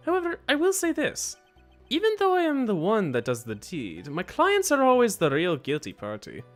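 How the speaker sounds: high starts falling away, put-on accent; accented hitman character. Killer explains his client's guilt, accented character voice. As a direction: declare it as a formal accented killer.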